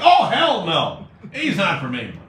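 hammed-up indignant holler, then easing; outraged denial. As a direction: explode in disbelief at the caller. Acted outburst in retold argument.

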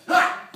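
loud, explosive "ha"; sharp kick shout; martial arts yell. Shown as a demonstration. Coach showing a student the jump, loud kick yell. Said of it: "jump like this one".

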